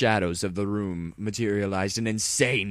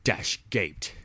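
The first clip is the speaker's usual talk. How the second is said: ordinary delivery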